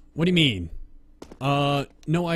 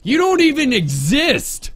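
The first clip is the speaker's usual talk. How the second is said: high, loud outburst; heated shout; 11 semitones higher, 7 dB louder than usual. Real, not acted. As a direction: shout it down at an opponent. Yelling at a player mid-game.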